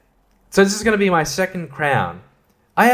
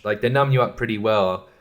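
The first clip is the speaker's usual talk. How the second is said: ordinary delivery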